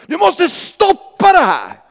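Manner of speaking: high, slow, loud shout down the phone; shouting pastor. Retold or imitated. Pastor yelling the order over the phone, loud and slow. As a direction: yell it like an alarmed pastor. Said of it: "han bara skriker i telefonen".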